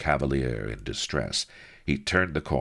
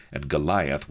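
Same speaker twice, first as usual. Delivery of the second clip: ordinary delivery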